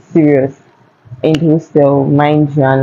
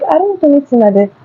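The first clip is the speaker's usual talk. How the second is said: voiced waitress; 10 semitones higher, faster than usual.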